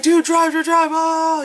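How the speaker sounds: high, loud frantic urging; mock panic. Guys yelling at the driver to escape, high mock panic. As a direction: imitate someone desperate to get away.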